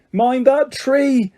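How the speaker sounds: high, slow drawn-out bellow. A narrator's reading. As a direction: bellow a warning, slowly. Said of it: "Harry bellowed".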